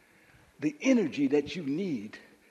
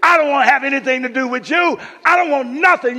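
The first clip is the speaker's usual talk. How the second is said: loud, raised refusal, acted out; hurt, angry Mary; 7 semitones higher, 15 dB louder than usual. Mary rejecting Jesus after her brother's death, angry refusal. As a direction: push him away in a preacher's big voice. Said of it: "What Mary's disposition was saying is".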